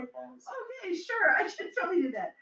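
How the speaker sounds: high, soft mock-willing reply; mock agreeable. Retelling own answer to the combining task, lightly mock-agreeable.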